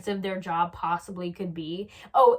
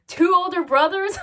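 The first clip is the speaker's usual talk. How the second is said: high, loud, incredulous question; mock hilarity; 10 semitones higher, 10 dB louder than usual. Shown as a demonstration. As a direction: pretend it's the funniest thing ever. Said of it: "act like you are just having the best time".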